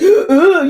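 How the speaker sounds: loud, high heaving sob sound; retold gasping heave of crying. Recalling the sisters carrying her out, a loud heaving sob. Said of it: "I'm heaving now".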